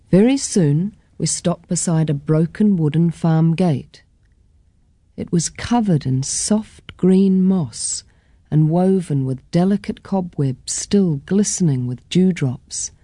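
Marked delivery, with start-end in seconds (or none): none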